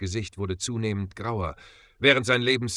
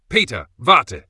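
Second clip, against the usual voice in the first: a loud, higher warning call; sudden shout; 3 semitones higher, 10 dB louder than usual. A narrator's reading. Justus warns Peter too late, loud sudden shout. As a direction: yell to stop him before the trap. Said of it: "rief Justus plötzlich".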